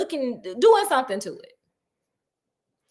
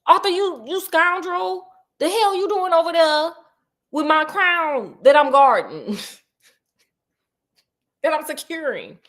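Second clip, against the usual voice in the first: mock outraged father; 5 semitones higher, 3 dB louder than usual. Father catches son with crown.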